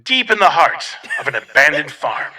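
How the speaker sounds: loud, booming trailer narrator; microphone voice. Pretend. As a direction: boom it out like a haunt trailer. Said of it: "we'll do a microphone voice for this one".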